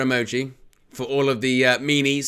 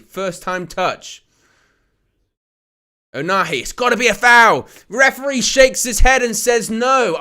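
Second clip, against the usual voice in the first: excited play-by-play, louder on the big moments; live match commentary; 4 semitones higher, 4 dB louder than usual. Acted.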